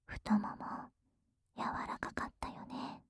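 whispering. Acted. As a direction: whisper it gently, up close. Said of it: "ささやいてあげる".

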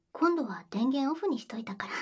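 ordinary talk

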